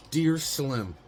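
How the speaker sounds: [name] letter. Retold or imitated. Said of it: "Kinda feel like [name] and, uh, [name]".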